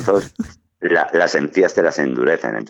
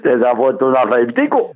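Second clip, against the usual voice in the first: put-on teasing Caribbean Spanish accent, higher; 7 semitones higher than usual. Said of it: "un acento caribeño".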